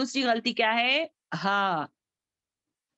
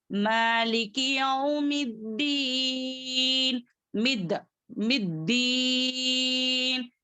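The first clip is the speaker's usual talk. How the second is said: slow, level-toned verse with elongated vowels; Quran recitation; 2 semitones higher, much slower than usual. Shown as a demonstration. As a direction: recite measuredly, holding the long syllables. Recites verse with long final hold.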